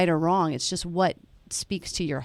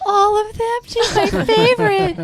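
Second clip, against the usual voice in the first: much higher, louder, hammed-up adoring fan voice; gushing mock fan; 7 dB louder than usual. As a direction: play him as a gushing, swooning fan, way up high. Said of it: "He's gonna go".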